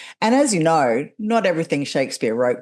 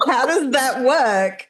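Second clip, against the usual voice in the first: high, drawn-out baffled question; incredulous; 9 semitones higher, slower than usual. Real, not acted. Coach questioning prose spoken in metre, amused incredulity. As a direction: stretch it out in amused bafflement, pitched up.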